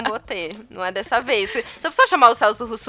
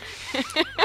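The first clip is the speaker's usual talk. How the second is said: a sudden squeal of a laugh, much higher; high burst of laughter; 4 dB quieter than usual. Real, not acted. Laughing at her own sarcastic reply to boyfriend.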